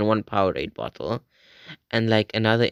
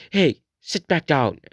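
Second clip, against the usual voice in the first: stern store owner; 6 semitones higher, 3 dB louder than usual. Retold or imitated. Store owner orders him to sit.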